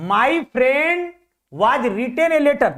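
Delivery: sentence read slowly in two parts; slow reading aloud. Shown as a demonstration. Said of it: "चल आगे देखो". (प्रश्न वाक्य को धीरे पढ़ना)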